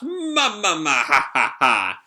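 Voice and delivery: hammed-up laugh in separate loud syllables; booming, put-on villain laugh; Big Mom laugh. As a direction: start high and drop with each beat. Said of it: "Big Mom just laughs".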